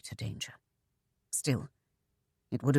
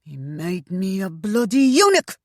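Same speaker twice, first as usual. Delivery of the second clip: louder furious cry; angry outburst; 4 semitones higher, 5 dB louder than usual. Acted. Mother rages at his mutilation, loud and furious. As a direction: spit it out in rage. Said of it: "His fist crashed down to emphasize".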